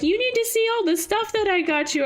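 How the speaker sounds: high, quick, eager gushing; excited gift-giving. Retold or imitated. Retold excitement handing over gift.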